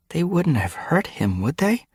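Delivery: very high, anxious pleading; worried question. A narrator's reading. Frostpaw fearing for the prisoner, worried question. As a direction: pitch it up, seeking reassurance.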